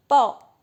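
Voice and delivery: pó alone, punched loud; stressed syllable. Shown as a demonstration. Teacher picking out the last syllable, loud stressed pó. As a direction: isolate pó as one strong loud beat. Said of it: "é a mais forte".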